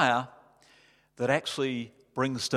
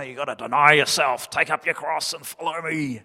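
mock burdened voice, a bit louder; mock heavy burden; 4 dB louder than usual. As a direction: say it like a grim chore. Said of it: "It sounds like a heavy burden".

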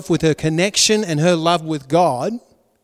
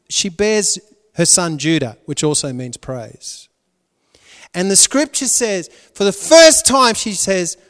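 normal talking voice

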